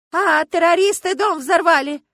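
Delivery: loud, high panicked shout; a woman in the crowd shouting in alarm; crying out. A narrator's reading. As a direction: cry it out, high and drawn out. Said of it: "кричала какая-то женщина".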